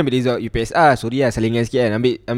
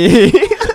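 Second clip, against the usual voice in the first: high laughing burst; loud laugh; 7 dB louder than usual. Real, not acted. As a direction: crack up laughing. Laughing again at joke. (gelak kuat)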